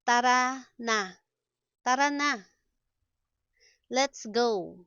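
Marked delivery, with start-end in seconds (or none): 0.0-1.1 words set apart by a pause. Shown as a demonstration. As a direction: separate each word for learners. Slow model, then natural speed.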